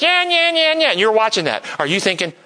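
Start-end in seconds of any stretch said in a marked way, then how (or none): 0.0-0.9 mock nagging.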